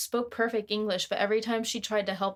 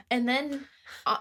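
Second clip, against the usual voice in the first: ordinary delivery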